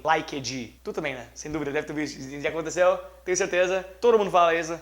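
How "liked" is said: "Liked" with a full extra vowel, "lai-ked-ji"; exaggerated wrong liked. Shown as a demonstration. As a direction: stretch "Liked" into three syllables, badly. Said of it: "versão super evoluída Pokémon vezes dez de ruim". ("Liked" pronunciado errado, com E e I no final)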